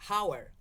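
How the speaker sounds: "Hour" with the silent H voiced; sounded H. Shown as a demonstration. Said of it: "why don't you sp-, say it like four".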